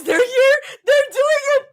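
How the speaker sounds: high, loud thrilled cry; breaking into a high shout; excited yelp. Real, not acted. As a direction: squeal the news to everyone. Freaking out that guests arrived.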